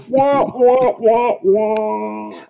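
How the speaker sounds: high falsetto wah wah whimpering; mock wailing. Mocking Troi to cohost, high whimpering wah wah. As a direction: whine it like a sobbing empath. Mocking Troi's empath whining.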